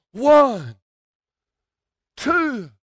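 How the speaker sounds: preacher plays the referee, mildly counting one, two. Retold or imitated. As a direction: count it like the ref slamming the mat.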